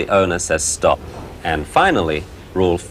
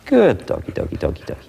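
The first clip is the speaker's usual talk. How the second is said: cooing praise; 3 semitones lower, faster than usual. Real, not acted. Praising puppy high.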